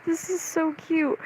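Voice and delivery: high, soft gushing voice; imitated cooing. Quoting the idol's comment on the cosplay, cooing. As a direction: coo the compliment high and softly.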